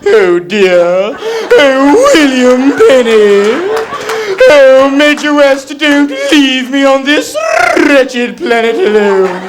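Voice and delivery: hammed-up cowardly Doctor Smith pleading; Doctor Smith impression. Stranded on a planet begging Major West, high whining wail. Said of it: "wacky impressions of the set".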